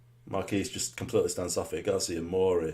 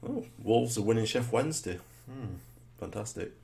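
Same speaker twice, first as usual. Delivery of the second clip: normal talking voice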